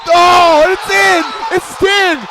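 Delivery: very high, very loud cheer; real screaming celebration; ecstatic shout. Real, not acted. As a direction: erupt as the shot drops. Basket scored in final seconds.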